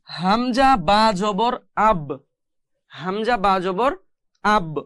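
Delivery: slow spelling, then "আব" with a bouncing b; qalqalah. Shown as a demonstration. Teacher demonstrating qalqalah to students, "আব" ending in an echoed b. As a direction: release the final b of "আব" with a short echo. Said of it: "কলকলাটা মূলত আমি কিভাবে উচ্চারণ করতেছি".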